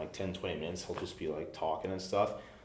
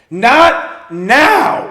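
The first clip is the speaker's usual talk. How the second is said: sharp shout, much higher and louder; angry shout; 19 dB louder than usual. Retold or imitated. Rock star yelling at the pushy fan. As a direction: bark it back furiously.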